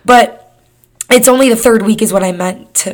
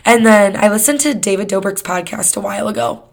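ordinary talk

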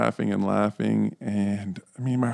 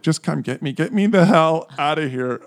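mock desperate plea; 6 semitones higher than usual. Acted desperate call to mom.

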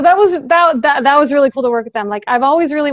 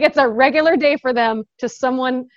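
ordinary talk, nothing marked

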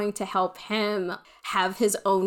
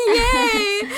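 mock cheer; 14 semitones higher, 6 dB louder than usual. Mock cheer after compliment.

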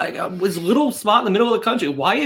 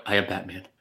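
much lower, much softer gravel-free Batman growl; 13 semitones lower, 9 dB quieter than usual. Retold or imitated. Co-host mocks his Baltimore line as Keaton's Batman. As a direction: do Keaton's Batman, low and hushed. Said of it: "You say it like Keaton".

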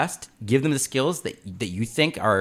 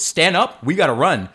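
lightly voiced mother deer, louder; urgent deer mom; 4 semitones higher, 6 dB louder than usual. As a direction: urge a newborn to get moving. Voices deer mom urging newborn.

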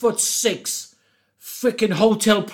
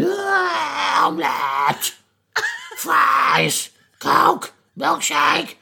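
strained, higher croak ordering food; croaky hungover voice; 9 semitones higher, much slower than usual. Retold or imitated. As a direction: force out each word like a zombie. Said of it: "Is something wrong with your voice?".

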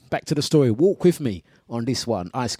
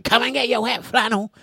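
high hawker call through megaphone; 8 semitones higher than usual. Pretend. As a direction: call it out like a loudspeaker van. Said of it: "she's got the megaphone".

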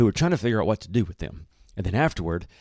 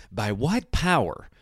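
slow, drawn-out interrogation; demanding; 6 semitones higher, slower than usual. A narrator's reading. Council questioning the two disciples, demanding. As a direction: demand an answer, rising higher on the second question. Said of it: "and demanded".

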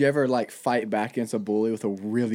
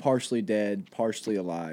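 lower, subdued and a little sad; a touch of sadness; 5 semitones lower, 4 dB quieter than usual. Real, not acted. Host describing an animal at the market, sadly. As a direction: let it sink into mild gloom. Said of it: "didn't mean to get sad".

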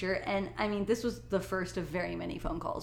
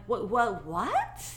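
shocked disbelief; 4 semitones higher than usual. Retold or imitated. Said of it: "And I'm like".

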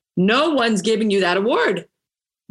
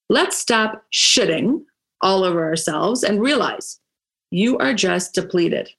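usual speaking voice

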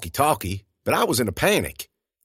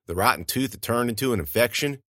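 ordinary delivery, nothing marked

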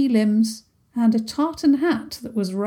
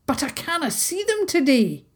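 put-on chattering haggis in a broad Scots accent; dramatic little haggis with a thick Scottish accent; 6 semitones higher than usual. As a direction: gossip it like an old Highland beastie. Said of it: "his thick accent".

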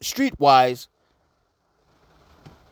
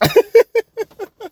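high-pitched burst of laughter. Real, not acted. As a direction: laugh high at the absurd idea. Laughs at imagined Nintendo praise.

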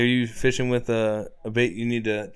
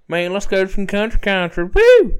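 rowdy country fan; 8 semitones higher, 8 dB louder than usual. Retold or imitated. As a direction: holler like a rowdy cowboy. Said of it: "and he'd go".

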